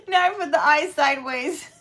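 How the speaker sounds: laughing through the words; can't stop laughing at a mistake. Real, not acted. Laughing at her own mistake.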